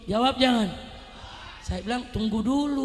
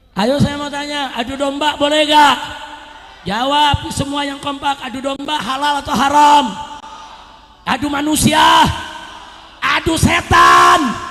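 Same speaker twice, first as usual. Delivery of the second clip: loud rallying questions to the crowd; full-force preaching; 4 semitones higher, 16 dB louder than usual. Real, not acted. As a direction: fire questions out to the whole crowd.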